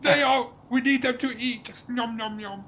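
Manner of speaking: high cat-alien gloat; booming arrogant alien overlord. Pretend. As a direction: menace them like a cartoon cat. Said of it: "And then say".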